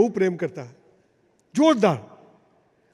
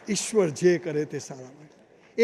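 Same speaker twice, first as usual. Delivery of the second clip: softer, slow solemn moral; 5 semitones lower, 5 dB quieter, slower than usual. Acted. Preacher gives audience the moral, slow and soft. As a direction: let the lesson sink in slowly. Moral stated after story.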